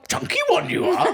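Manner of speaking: very high, hammed-up accented squeal; over-the-top high comic accent, close to laughing; full comic accent. Shown as a demonstration. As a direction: belt out the silly catchphrase voice in full. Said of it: "Do, do the full thing".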